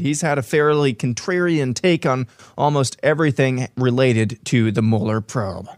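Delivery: ordinary delivery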